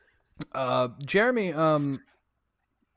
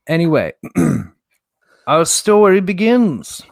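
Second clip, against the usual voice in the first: story narration; 11 dB louder than usual. Acted. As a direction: announce the tale to the table at full volume. Game master opens with recap.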